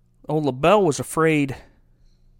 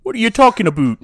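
indignant, exaggerated Canadian accent; mock Canadian accent; 3 dB louder than usual. Host defending the accent to a co-host, indignant Canadian. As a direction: protest in a hammy Canadian accent. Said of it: "They sound awfully like this".

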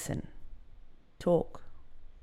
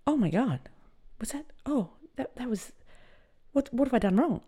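flustered panic; 10 semitones higher than usual. Retold or imitated. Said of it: "she's like".

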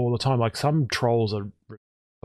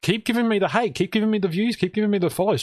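mock pleading; 7 semitones higher than usual. Streamer begging troll for more hate, mock pleading. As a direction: plead eagerly, item after item. Begs troll to keep hating.

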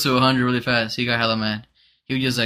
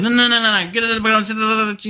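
loud, high, garbled mock rant; angry fast-talking shopkeeper; 10 semitones higher, 4 dB louder than usual. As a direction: cut them off with a heated babble of refusals. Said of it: "how they talk a little fast".

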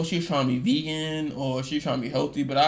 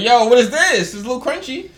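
puzzled exclamation; 8 semitones higher, 8 dB louder than usual. Retold or imitated. Retold puzzled bite of spaghetti.

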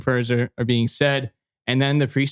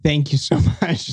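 profuse thanks; slower than usual. Retold or imitated. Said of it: "thank the priest ... profusely".

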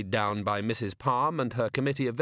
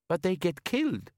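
high worried woman's voice; high anxious young woman; 7 semitones higher than usual. Acted. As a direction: object like a worried girl.

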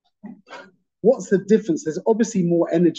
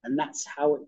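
usual speaking voice

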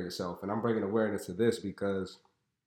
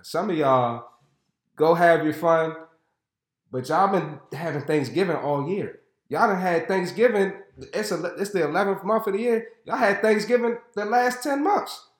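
louder and higher, building exasperation; rising exasperated scold; 8 semitones higher, 6 dB louder than usual. Real, not acted. Scolding audience about overeating.

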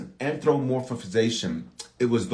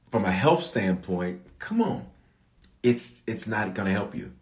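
ordinary talk

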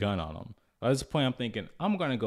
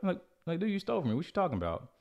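incredulous; 9 semitones higher, faster than usual. Retold or imitated. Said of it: "I was like".

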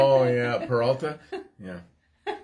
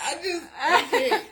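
laughing; 11 semitones higher, faster than usual. Real, not acted. Laughing, overcome.

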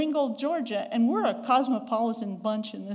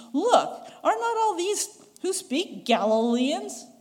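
amazed exclamation; 6 semitones higher, 4 dB louder, slower than usual. Acted. Amazed crowd quoted.

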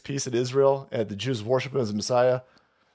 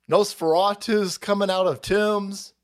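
ordinary delivery

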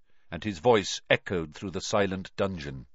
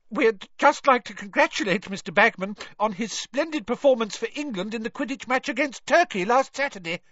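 high breathless witch voice; gushing, short of breath; 12 semitones higher, 3 dB louder than usual. A narrator's reading. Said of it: "the witch said breathlessly".

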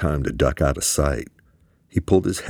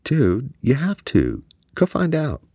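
imploring; 11 semitones higher than usual. A narrator's reading. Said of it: "Quan implored".

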